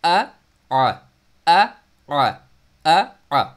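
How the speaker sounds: throat-jump vowel drill; hamza–ain drill. Shown as a demonstration. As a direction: alternate a stopped अ and a throaty आ. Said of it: "jump करना है middle throat पे".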